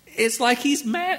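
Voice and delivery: normal talking voice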